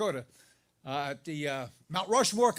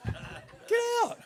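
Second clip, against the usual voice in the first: high startled yelp; surprised squeak. Retold or imitated. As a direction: pitch shoots up in disbelief. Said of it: "My voice goes up when I'm surprised".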